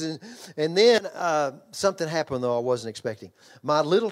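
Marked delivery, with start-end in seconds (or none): none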